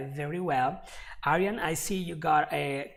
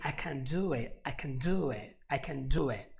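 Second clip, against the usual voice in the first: each "DO" punched over a light "can"; stress on DO. Shown as a demonstration. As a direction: drill the phrase with the energy on "do". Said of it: "All the energy goes towards do it".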